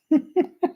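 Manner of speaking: sudden high laugh; high cackle. Real, not acted. Ranting about women, high cackle. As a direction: cackle at his own insult.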